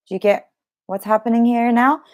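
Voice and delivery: usual speaking voice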